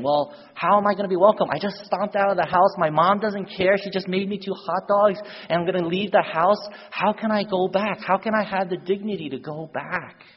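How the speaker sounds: high, loud, urgent self-questioning; distressed fretting. Acted. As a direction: act out a boy working himself up with worry. Boy frets whether he'll be welcome.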